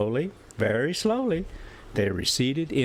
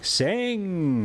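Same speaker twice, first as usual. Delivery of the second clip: put-on drunken command to the creatures; rowdy drunken call to sing; shout; 8 semitones higher than usual. A narrator's reading. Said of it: "I heard Montgomery shout".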